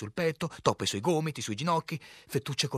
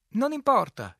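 high, loud, cheering call; encouraging shout; 13 semitones higher, 9 dB louder than usual. A narrator's reading. Harlequin consoling the captain, encouraging shout. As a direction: shout it to buck someone up. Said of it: "gridò in tono incoraggiante".

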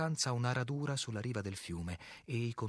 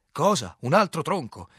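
loud, raised, exasperated question; cursing outburst; 6 semitones higher, 10 dB louder than usual. A narrator's reading. Captain hearing of another snag, loud exasperated outburst. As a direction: burst out as if swearing. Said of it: "bestemmiai spudoratamente".

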